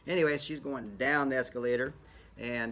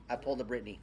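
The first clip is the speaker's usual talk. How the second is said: ordinary talk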